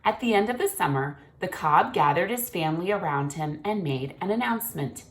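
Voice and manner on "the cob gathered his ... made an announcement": ordinary delivery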